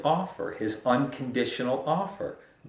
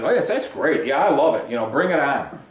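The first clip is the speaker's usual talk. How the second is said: louder, hammed-up eager welcome of the trial; mock eagerness; 3 semitones higher, 6 dB louder than usual. Pastor mocks welcoming the refining fire, hammed-up eagerness. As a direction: play a fake fan of suffering. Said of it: "I don't know anybody that says".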